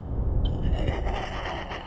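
a breathy, menacing low chuckle; soft villain's snicker; sinister low chuckle. Acted. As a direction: laugh quietly like a gangster gloating. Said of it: "câu nói của Long Nghiện".

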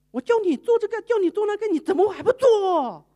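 high, loud scolding of the maid; angry scolding. Retold or imitated. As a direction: scold her like an angry boss. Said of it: "就发脾气给这个工人". (生气地大声责骂)

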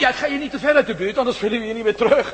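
shouting a warning to a friend; calling out. Acted. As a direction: call after him as he walks off. Calling to wandering cameraman.

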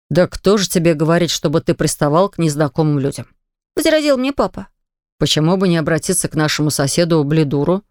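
a hint of a father reasoning gently; lightly voiced patient father. A narrator's reading. Father explaining to his son on his knees, low and patient.

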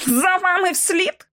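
high, drawn-out incredulous question; scornful scoff. A narrator's reading. Kipps jeers at Lockwood's accusation, scornful scoff. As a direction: throw it back with contempt, slowly. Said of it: "зневажливо зареготав Кіпс".

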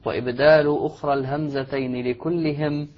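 slow, measured chanting of a poem; verse recitation. Acted. Shaykh reading the verse from the screen in slow chant. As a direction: intone the line slowly, savouring each word.

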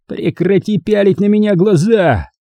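high, irate command; growled order. A narrator's reading. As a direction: order the staring to stop, angrily. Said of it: "прорычал Хорд".

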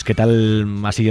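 ordinary talk

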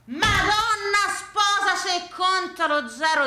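put-on high, slow old man's cry; grandfather's exclamation. Grandfather protesting the zeros, high slow exclamation. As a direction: draw out the oath like the old grandfather. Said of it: "diceva il mio nonno".

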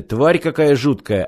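ordinary delivery

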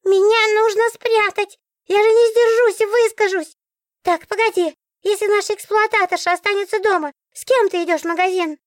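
very high, squeaky fussy book; a fussy magic book, fired up; talking book voice. A narrator's reading. (очень высокий голос говорящей книги)

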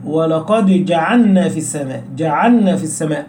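"جعلنا" as ja'anna inside the quick phrase; lam merged into nun. Shown as a demonstration. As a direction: careless recitation, ل of "جعلنا" vanishing into ن. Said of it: "قد تضغم اللام في النون". ("جعلنا" بإدغام اللام في النون خطأً)

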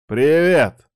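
ordinary delivery, nothing marked

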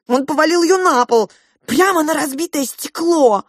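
high, loud, breathless-with-news telling; excited recounting. Acted. Child recounting parents' fight to pink mouse, agitated. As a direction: pile up the dramatic details, high and loud.

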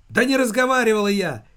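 high, loud squeal of denial; hysterical shriek. A narrator's reading. Mother denies talking to the caller, shrieking hysterically. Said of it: "истерически взвизгнула она".

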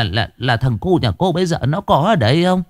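put-on high, hesitant old woman asking; hopeful mother asking about her dead son; anxious question. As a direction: ask timidly, stumbling at the start.

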